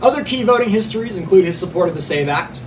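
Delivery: ordinary delivery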